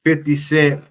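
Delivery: loud, punchy host hype; hype intro. Acted. Streamer opening his show to viewers, loud hype. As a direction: shout the show open like a hype man.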